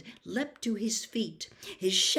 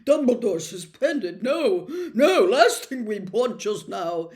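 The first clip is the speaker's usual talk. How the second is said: loud, flustered nervous laugh; alarmed; 11 dB louder than usual. Acted. Fudge reacts to the suspension, loud and alarmed. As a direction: panicky official laughing it off. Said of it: "looking alarmed".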